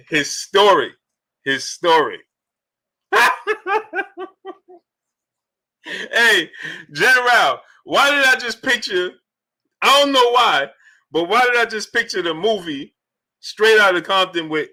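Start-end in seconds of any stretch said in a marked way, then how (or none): 3.1-4.2 squeaky high laugh; high giggle. Real, not acted. 6.1-11.6 amused. Real, not acted. Laughing, amused tease.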